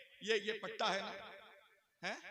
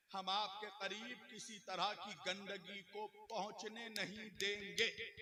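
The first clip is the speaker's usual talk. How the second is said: ordinary talk, nothing marked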